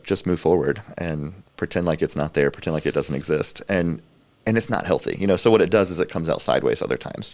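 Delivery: ordinary delivery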